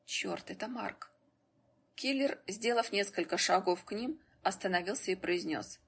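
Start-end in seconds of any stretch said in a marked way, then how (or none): 0.1-1.1 hushed, slow whisper; a slow, hushed aside. A narrator's reading. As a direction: whisper it slowly, much softer. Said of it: "только один произнёс шёпотом".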